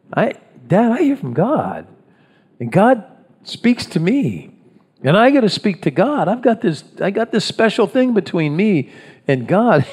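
mock self-importance, pitched high; self-important boasting. Plays self-important Moses boasting to father-in-law.

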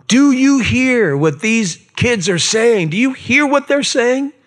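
put-on a priest sputtering in outrage at the kids; indignant priests. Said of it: "they were indignant. They were steamed, angry".

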